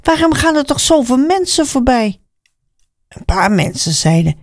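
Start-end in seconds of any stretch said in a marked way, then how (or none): 0.0-2.2 high-pitched wondering question; calling out puzzled. A narrator's reading. Blind man asks why so many people pass, calling out. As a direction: call it out high, wanting an answer. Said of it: "riep Bartimaeus".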